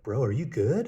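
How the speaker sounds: loud, high challenging bro voice; loud high bro confrontation; 1 stranger's challenge. Retold or imitated. Stranger confronts him in car.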